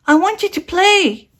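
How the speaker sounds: a tiny eager bear cub pleading; little bear voice. A narrator's reading. Little Bear's voice in story.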